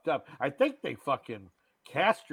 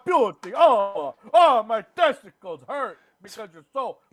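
hammed-up Italian accent, much higher and louder; mock Italian accent; 10 semitones higher, 11 dB louder than usual. Mocking Nicolas Cage's movie role, hammed-up Italian accent. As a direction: overblown Italian lover accent, pitched way up. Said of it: "There are moments like".

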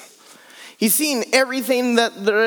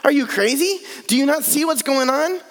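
incredulous; 6 semitones higher than usual. Retold or imitated. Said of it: "If I was the servant, I'd be like".

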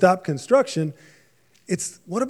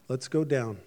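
softer and lower, unhurried and settled; calm; 4 semitones lower, 8 dB quieter than usual. Retold or imitated. God answering the builders of Babel, calm and soft. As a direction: answer the boast quietly, unbothered. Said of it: "God's answer was a calm".

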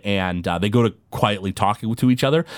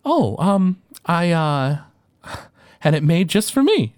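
higher, slow sweet gnome lady; gnome woman voice; 6 semitones higher, slower than usual. Acted. Rock gnome woman greeting the player sweetly. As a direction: greet them with sugary delight.

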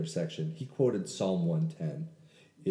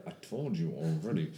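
through clenched teeth; 3 semitones lower than usual. Retold or imitated. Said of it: "through clenched teeth".

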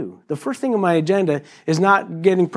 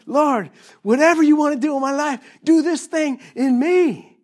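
earnest prayer; 11 semitones higher than usual. Retold or imitated. Voicing a prayer to God.

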